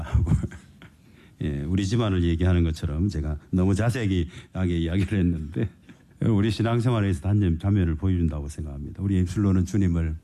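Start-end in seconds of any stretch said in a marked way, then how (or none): none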